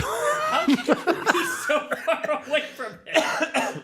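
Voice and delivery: high, drawn-out helpless laughter through the words; laughing hard. Real, not acted. Co-host mocks failed accent, laughing hard. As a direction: splutter it out while cracking up.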